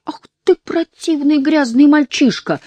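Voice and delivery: high, furious scolding; angry shout. Acted. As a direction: yell with disgust. Wolf-woman scolding boy.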